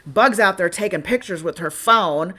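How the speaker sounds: ordinary talk, nothing marked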